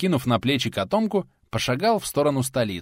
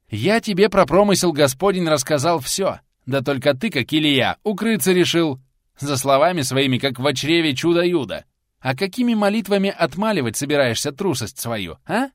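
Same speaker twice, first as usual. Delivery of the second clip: heated scolding; 6 semitones higher, 5 dB louder than usual. Acted. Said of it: "уже не на шутку разошёлся".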